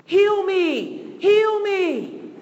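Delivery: loud, high plea to God; crying out. Retold or imitated. Preacher voicing desperate prayers to God, crying out. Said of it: "We cried".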